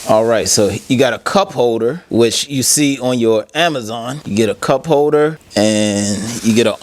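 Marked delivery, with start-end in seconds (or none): none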